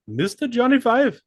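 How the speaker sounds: high, loud hype call; hype announce. Pretend. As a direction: announce him like a ring entrance. Hyping his guest.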